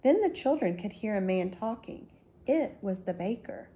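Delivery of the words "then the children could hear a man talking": ordinary delivery, nothing marked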